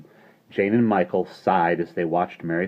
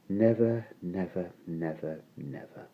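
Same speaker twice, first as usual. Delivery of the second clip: softer and softer until gone; fading away; 8 dB quieter than usual. A narrator's reading. Jane's nevers fading into silence. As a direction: let each never drift off.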